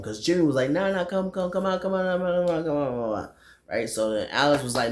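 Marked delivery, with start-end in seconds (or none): 0.7-3.4 high, rapid-fire mock begging; high, run-together coaxing; mock pleading. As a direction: imitate a friend pestering them to come. Friend begs others to stay out.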